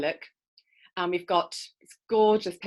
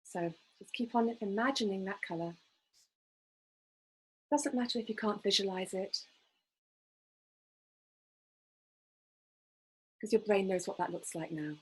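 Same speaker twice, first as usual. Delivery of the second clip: guided meditation; 6 dB quieter than usual. Acted. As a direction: gently guide listeners into calm. Leads a breathing visualization.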